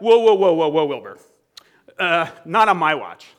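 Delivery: emphatic halting protest. Acted. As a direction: cut in hard to stop a friend. Acted Peter protesting, halting Jesus.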